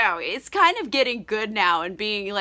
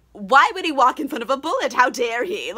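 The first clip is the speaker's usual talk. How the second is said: louder, hammed-up outrage; mock indignation; 4 semitones higher, 4 dB louder than usual. Shooters blaming the man they shot, mock indignant. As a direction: sound affronted, as a rich snob would.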